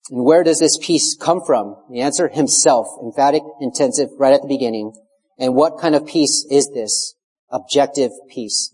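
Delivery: usual speaking voice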